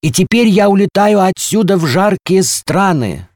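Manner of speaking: very high, loud announcement; at full voice. A narrator's reading. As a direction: proclaim the departure for all to hear. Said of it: "сказал он во весь голос". (громко и высоко, во весь голос)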